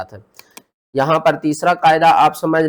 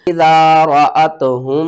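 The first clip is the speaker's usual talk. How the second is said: slow, louder chanted recitation; Quran recitation; 6 dB louder, slower than usual. Shown as a demonstration. Teacher shows idgham shafawi, slow louder recitation. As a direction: recite it out with full voice. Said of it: "किस तरह?".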